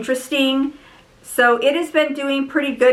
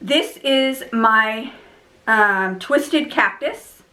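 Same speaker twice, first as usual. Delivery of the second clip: usual speaking voice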